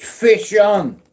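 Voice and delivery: loud, high call of fish on; excited shout. Retold or imitated. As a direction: shout it like a hooked catch. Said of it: "I could hear them fucking words".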